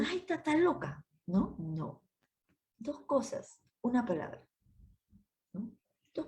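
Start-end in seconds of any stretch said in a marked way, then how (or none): 0.1-1.1 a touch of a scoffing husband; husband's scoff. Retold or imitated. Sister imitating a husband mocking his wife. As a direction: scoff at her as crazy, lightly. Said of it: "Lo que dice".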